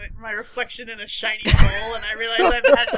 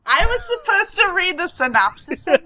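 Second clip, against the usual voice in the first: rising, louder with each listen; loud insistent; 8 semitones higher, 4 dB louder than usual. Real, not acted. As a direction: shout them down to get the floor. Cutting in over teasing hosts.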